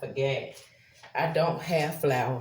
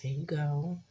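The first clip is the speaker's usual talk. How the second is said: ordinary talk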